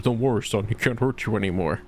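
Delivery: low, reassuring officer voice; police officer. Acted. Police officer comforts the orphan, low and reassuring. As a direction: drop low like a calm cop. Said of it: "Police officer comes up".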